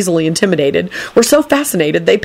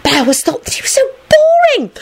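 put-on very high girlish voice; gossiping schoolgirl. A narrator's reading. Schoolgirl gossiping about Professor Longbottom, girlish gushing. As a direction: gush in a high girly pitch.

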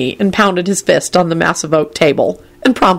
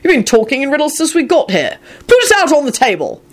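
high-pitched heated demand; angry snap; 9 semitones higher than usual. A narrator's reading. Arthur challenging Kingsley's insinuation, angry. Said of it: "Arthur snapped out angrily".

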